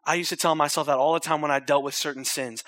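normal talking voice

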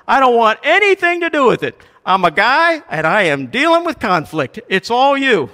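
loud, high, hammed-up refusal to get involved; exasperated disowning. Husband dodging conflict with his wife, loud and exasperated. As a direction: throw it all back at her at full volume. Acting Abraham avoiding the conflict.

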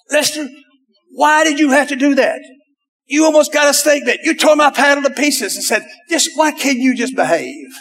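high-pitched exasperated scolding; upset scolding. Retold or imitated. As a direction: scold him like a fed-up family man. Upset scolding after paddle smashed.

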